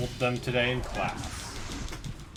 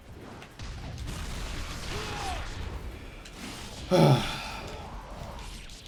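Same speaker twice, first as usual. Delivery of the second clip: long sigh; 6 dB quieter than usual. Real, not acted. Pained sigh mid-sentence.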